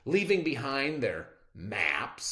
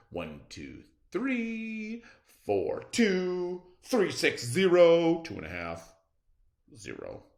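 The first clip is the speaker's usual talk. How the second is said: goofy count; 8 semitones higher, 6 dB louder than usual. A narrator's reading. Tacky's odd march again.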